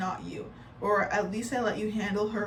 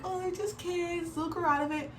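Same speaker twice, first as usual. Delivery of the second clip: dismissive mocking quote; 8 semitones higher than usual. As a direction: mimic the smug people brushing it off. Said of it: "I hate when people are like".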